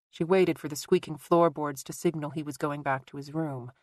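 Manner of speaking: ordinary delivery, nothing marked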